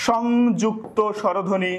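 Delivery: slow, spelled-out সংযুক্ত স্বরধ্বনি; teacher slowly dictating the new term; slow clear repeat. Shown as a demonstration.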